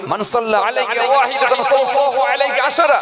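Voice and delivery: loud, high chanted Arabic; Arabic recitation. Acted. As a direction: intone the hadith.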